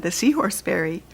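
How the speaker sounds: plain reading voice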